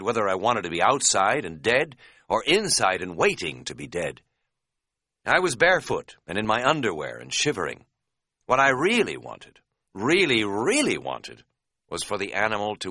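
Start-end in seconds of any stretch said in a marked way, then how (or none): none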